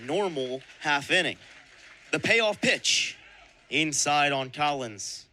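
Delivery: normal talking voice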